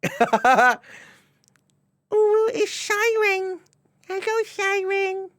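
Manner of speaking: high, slow cutesy uwu voice; uwu accent. Pretend. Greeting chatter Shiren in a high cutesy uwu voice. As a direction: coo the name in a high baby uwu voice. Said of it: "like, an uwu accent".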